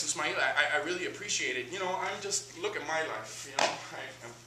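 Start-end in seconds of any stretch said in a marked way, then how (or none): none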